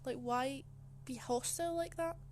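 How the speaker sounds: ordinary talk, nothing marked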